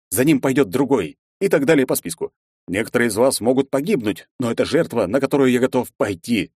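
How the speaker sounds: rally them like a commander; fiery revolutionary speech. Acted. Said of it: "зажигательную речь".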